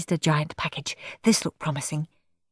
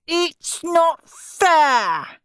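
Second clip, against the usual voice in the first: very slow, high drawn-out wail; howling; 10 semitones higher, 4 dB louder, much slower than usual. Acted. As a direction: howl out the unfairness. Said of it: "howled Steve".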